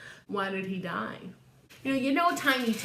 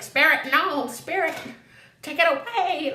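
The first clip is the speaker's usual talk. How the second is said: mock frightened plea; 6 semitones higher, 6 dB louder than usual. Scrooge begging the ghost.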